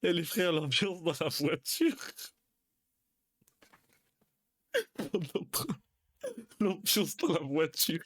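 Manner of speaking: can't get through it for laughing. Real, not acted. Recalling the awkward rap moment. (en riant, voix plus aiguë et lente)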